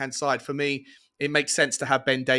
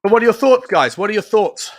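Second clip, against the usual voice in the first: much louder, higher call; calling out to viewers; 7 semitones higher, 11 dB louder than usual. Real, not acted. Host calling loudly to viewers at kickoff. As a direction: shout the question out to the chat.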